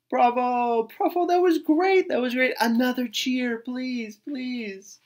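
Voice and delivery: high, loud cheering voice; excited crowd cheer. A narrator's reading.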